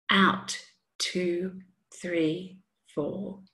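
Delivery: calm, measured counting cue; slow breath counting. Acted.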